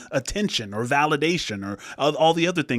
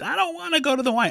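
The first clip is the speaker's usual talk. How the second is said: put-on very high, fast, wailing kid voice; child throwing a fit; 13 semitones higher, faster than usual. Dad mimicking his daughter's tantrum, very high fast wail. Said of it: "and throwing a fit".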